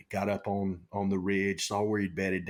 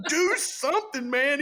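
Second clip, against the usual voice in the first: much higher, louder half-laughing yell; laughing shout; 4 dB louder than usual. Retold or imitated. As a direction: yell it at him while laughing. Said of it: "He goes".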